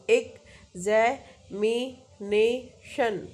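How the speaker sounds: slow, examination broken into separate syllables. Shown as a demonstration. Teacher showing students the chin-touch count, examination syllable by syllable. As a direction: say examination in drawn-out beats, pausing between syllables. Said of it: "और फिर आप बोलो".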